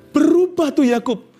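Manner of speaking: ordinary delivery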